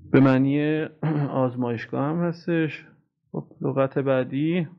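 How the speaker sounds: ordinary delivery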